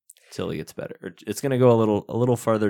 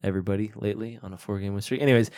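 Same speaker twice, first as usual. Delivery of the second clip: usual speaking voice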